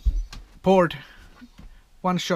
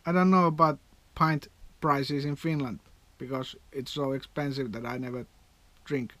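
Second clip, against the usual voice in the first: normal talking voice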